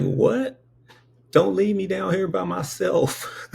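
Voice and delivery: high, pleading 'What? Don't leave me down here by myself.'; pleading baby. Retold or imitated. Baby begging the angels not to leave, high pleading. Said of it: "And I was like".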